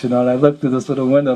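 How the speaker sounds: usual speaking voice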